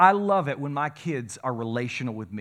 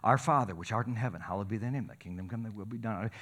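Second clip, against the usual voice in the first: soft, fading rote prayer; rote recitation; 6 semitones lower, 7 dB quieter, faster than usual. Retold or imitated. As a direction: recite it like a memorized chant. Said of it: "we recite it".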